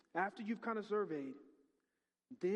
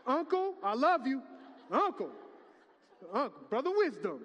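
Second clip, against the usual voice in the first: loud affectionate call-out; 5 semitones higher, 8 dB louder than usual. Real, not acted. Loud greeting to elders.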